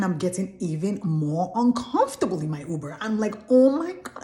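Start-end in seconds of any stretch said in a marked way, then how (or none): 3.5-4.2 alarmed gasp. Retold or imitated. Panic in locked Uber.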